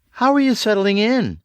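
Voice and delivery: bright, high cheery question. A narrator's reading. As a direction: put on cheer over tiredness. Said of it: "in as cheery a voice as she could muster".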